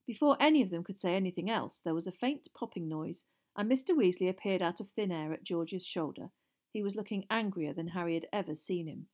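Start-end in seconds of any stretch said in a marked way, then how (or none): none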